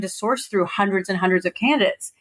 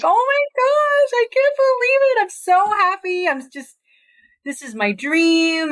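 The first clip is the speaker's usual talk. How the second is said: much higher, slow, overjoyed squeal; ecstatic screaming; 11 semitones higher, slower than usual. Retold or imitated. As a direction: shriek with disbelieving joy. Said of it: "like, screaming".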